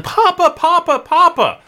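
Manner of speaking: put-on high, loud child's call for her father; girl running in calling her father; girl calling for papa. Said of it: "she starts saying".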